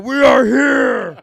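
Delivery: huge drawn-out high shout; army battle cry. Acted. Persian army coming through against Sparta, roaring battle cry. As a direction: roar it like an invading army. Rumbling voiced as charging army.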